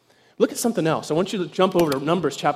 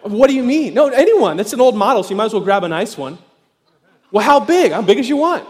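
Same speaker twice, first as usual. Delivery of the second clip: excited disbelief; 4 semitones higher, 7 dB louder than usual. Retold or imitated. Retold excited exchange with technician.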